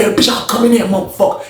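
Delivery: high, heated imitation of threatening; acted angry threat. Inmate on the bars threatening the officer, angry. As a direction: pitch it up, mock menacing.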